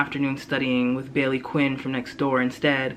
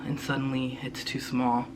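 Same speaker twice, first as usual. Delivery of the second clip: usual speaking voice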